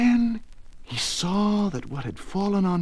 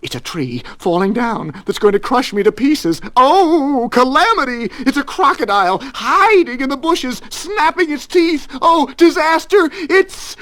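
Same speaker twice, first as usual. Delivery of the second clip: frantic, rising louder and higher, cut off abruptly; shriek building in panic; 12 semitones higher, 9 dB louder than usual. A narrator's reading. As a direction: spiral into comic hysteria. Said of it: "he shrieked".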